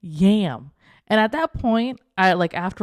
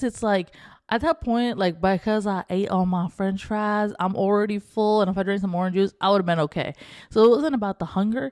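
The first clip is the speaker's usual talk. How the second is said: usual speaking voice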